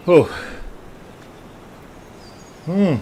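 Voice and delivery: ordinary talk, nothing marked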